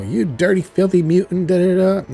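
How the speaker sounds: imitated angry tirade; mock outrage. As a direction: spit out a mock insult, loud and high. Acted angry villain quote.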